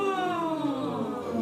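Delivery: high voiced yawn sliding down; yawn sigh. Shown as a demonstration. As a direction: yawn out a long sighing glide. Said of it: "So do it again".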